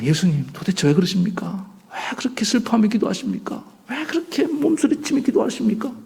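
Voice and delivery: whispered start rising to a high plea; pleading disciple. Acted. Preacher voicing a disciple anxiously questioning Jesus, rising pleading. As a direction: beg Jesus for an answer, rising.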